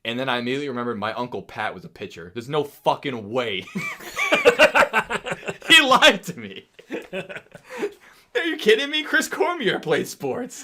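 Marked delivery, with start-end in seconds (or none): 3.7-5.6 high, loud burst of laughter; big laugh. Real, not acted. Friend realizing he was fooled, high loud laugh. 5.7-10.6 high, loud indignant disbelief; laughing outrage. Real, not acted. Friend realizing the teacher lied to him, laughing outrage.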